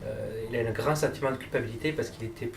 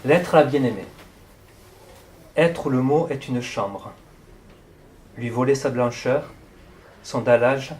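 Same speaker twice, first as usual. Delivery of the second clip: measured poem recital with long pauses; projected, deliberate reading of verse in short phrases; poetry reading; 3 dB louder than usual. Acted. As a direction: read it line by line, letting each phrase hang. Reads a poem aloud.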